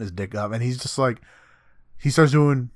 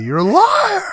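screeching accusation, far higher and louder; mock outraged scream; shrieking accusation; 8 dB louder, slower than usual. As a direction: shriek it like you've been betrayed. Echoing Leorio's angry outburst.

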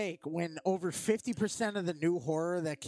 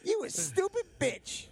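high comic yell; 13 semitones higher than usual. Retold or imitated. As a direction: shout it like a comedian. Comic Chris Rock Jigsaw riff.

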